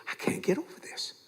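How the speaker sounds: hushed, breath-only disbelief; amazed whisper. Retold or imitated. Nurse watching a heart patient stand up, whispering in amazement. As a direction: imitate a stunned nurse under her breath.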